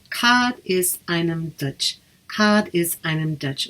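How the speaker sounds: slow, careful Irish "Cad is einem Dutch"; teacher modelling "Cad is einem Dutch" slowly; Irish phrase demo. Shown as a demonstration. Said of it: "So you would say".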